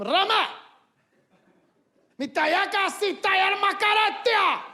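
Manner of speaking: high, loud mock-Arabic outburst; father yelling into the room in mock Arabic; angry-sounding father. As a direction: bark it like a stern dad. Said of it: "they sound angry".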